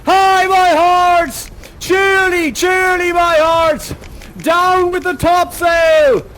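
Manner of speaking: high, loud shouted commands over the storm; ship's bosun yelling through the gale; bosun shouting orders. Acted. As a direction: bellow orders to the crew in a tempest. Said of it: "I'm gonna be the bosun".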